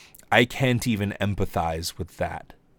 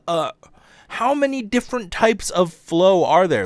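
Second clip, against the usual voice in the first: much higher and louder, outraged disbelief; furious rant; 12 semitones higher, 6 dB louder than usual. Real, not acted. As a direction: scream the question in outrage. Ranting about stolen flow.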